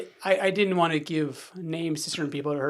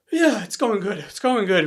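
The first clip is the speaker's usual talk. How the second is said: louder, slightly higher brush-off; imitated forced cheer; 5 semitones higher, 9 dB louder, slower than usual. Father covering tears after son's hug, forced upbeat. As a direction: cover the hurt with a too-loud reassurance.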